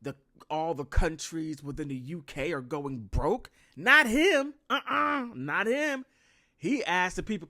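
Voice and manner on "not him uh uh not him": much louder and higher, half crying out; emphatic mock outcry. Real, not acted. Vlogger mocking William's indifference, wailing loudly. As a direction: wail it in mock protest.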